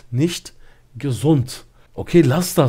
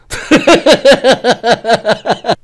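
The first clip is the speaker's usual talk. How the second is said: long hearty laugh, higher and louder; loud burst of laughter; 9 semitones higher, 7 dB louder than usual. Real, not acted. Storyteller bursts out laughing at his own line, hearty laughter. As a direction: crack up at your own punchline.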